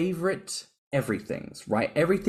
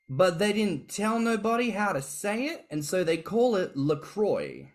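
ordinary talk

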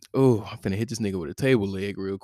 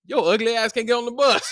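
high, loud taunt through laughter; laughing taunt; 12 semitones higher, 5 dB louder than usual. Retold or imitated. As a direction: laugh while yelling the insult.

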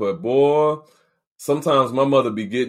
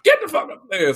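mock yelling aunt; 13 semitones higher than usual. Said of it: "if they was like".

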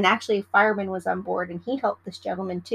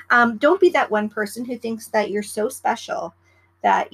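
usual speaking voice

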